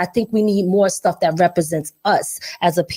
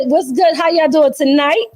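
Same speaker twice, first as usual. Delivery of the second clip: usual speaking voice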